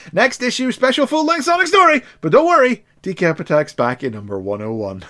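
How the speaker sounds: high, loud promo shout; hyped announcer. Acted. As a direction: hype the next issue like an announcer. Reads the next-issue announcement.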